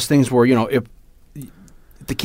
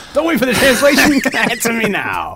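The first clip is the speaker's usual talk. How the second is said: laughing; 11 semitones higher than usual. Pretend. Joking demand mid-laugh.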